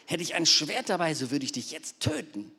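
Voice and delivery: loud, high threat that fades; angry threat. Acted. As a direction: threaten to kill her. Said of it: "rief Biliam".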